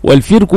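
والفرك as wal-firku; والفرك with its fa in kasra. Shown as a demonstration. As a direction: say والفرك with a short "i" after the f. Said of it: "بكسر الفاء".

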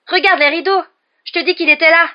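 high, loud frantic protest; panicked insistence. A narrator's reading. Ron argues with a doubting roommate, panicked shout. Frightened boy insists he was attacked.

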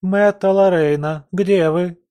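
high, drawn-out calling; maid's cry. A narrator's reading. Maid searching for her young mistress, calling out. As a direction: call out searching, voice lifted high. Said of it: "долетел крик горничной".

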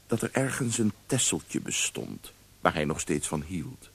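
usual speaking voice

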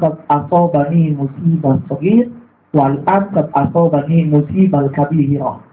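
solemn Quranic-style recitation; recited Arabic. Acted. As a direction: intone the Arabic words slowly. Reciting Arabic saying slowly.

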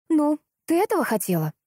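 high-pitched indignant question; mild outrage, voice lifted. A narrator's reading. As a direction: accuse her, exasperated. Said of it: "спросила возмущённо Лиза".

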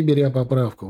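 ordinary delivery

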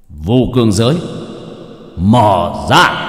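grave, steady incantation; deep steady voice. Acted. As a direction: intone it with calm gravity. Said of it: "giọng trầm định".

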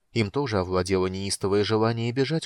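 usual speaking voice

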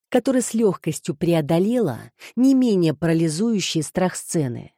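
usual speaking voice

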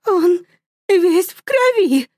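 high, slow, frantic cry; mother showing bloody hands, panicked. Acted. (высоко, в панике)

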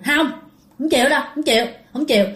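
high, odd-sounding stubborn refusal; possessed woman refusing the monk's offer; stubborn spirit. Retold or imitated. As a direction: refuse like a cornered ghost. Ghost refuses the monk.

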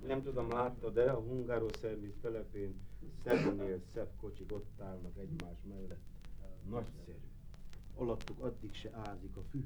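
very quiet, low murmur; confidential aside. Acted. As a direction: drop right down, as if telling it privately. Confidential aside about company cars.

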